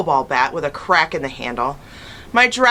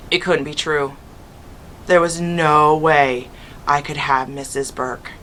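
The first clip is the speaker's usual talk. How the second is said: plain reading voice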